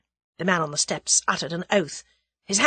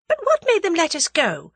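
high, louder probing question; suspicious demand; 11 semitones higher, 5 dB louder than usual. A narrator's reading. Tuppence questions why they were let go, suspicious. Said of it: "demanded Tuppence suspiciously".

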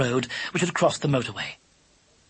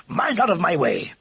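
mildly raised, gruffly impatient order; shouted warning; 2 semitones higher than usual. A narrator's reading. Lubber about to charge at the wire, mild shout. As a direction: clear them aside before charging. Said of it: "shouted Lubber".

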